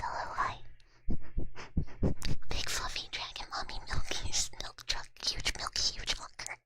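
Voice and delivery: usual speaking voice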